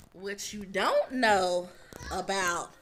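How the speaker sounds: ordinary talk, nothing marked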